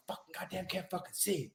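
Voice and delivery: quiet frustrated mutter; muttered curse. Retold or imitated. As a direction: grumble it half to yourself. Fumbling blind in the dark.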